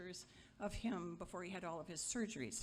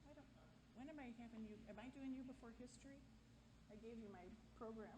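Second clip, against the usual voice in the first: much softer, slightly higher, quick check; hushed aside; 4 semitones higher, 12 dB quieter, faster than usual. Real, not acted. Speaker checks the program with a helper, hushed aside. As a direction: drop the volume for an off-mic question. Aside to someone about running order.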